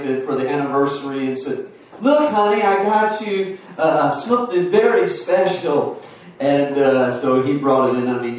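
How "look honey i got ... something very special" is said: lightly voiced husband, higher and eager; a hint of a pleased husband showing off a gift; proud husband. Husband presents anniversary gift.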